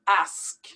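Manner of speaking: "Ask" with the S before the K; correct pronunciation. Shown as a demonstration. Teacher fixing the switched letters for learners, carefully pronounced. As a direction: clear S, then a final K on "Ask". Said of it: "have to pronounce it that way".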